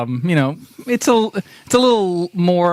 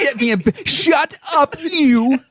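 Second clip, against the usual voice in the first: mock squabble; 8 semitones higher, slower than usual. Bickering mock voice.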